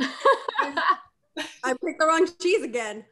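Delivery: slow and loud, laughing through it. Real, not acted. Laughing through a mock confession.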